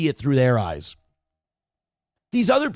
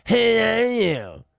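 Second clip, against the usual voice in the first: very slow, higher, put-on sneering "Who are you?"; mocking quote; 6 semitones higher, much slower than usual. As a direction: stretch "Who are you?" like a snooty protester. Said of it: "Who gives a shit?".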